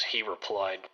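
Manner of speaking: ordinary delivery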